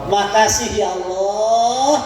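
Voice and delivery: slow, long-drawn call of thanks; overjoyed thanks. Shown as a demonstration. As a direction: draw it out as if overflowing with joy toward God. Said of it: "Saking senengnya sama Allah". (syukur bahagia, panjang mendayu)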